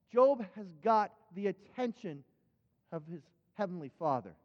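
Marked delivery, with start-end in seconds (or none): none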